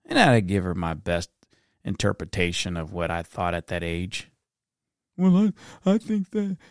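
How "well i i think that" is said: put-on a higher, small boy's voice; child's voice. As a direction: voice himself as a little kid. Boy answers grandmother in story.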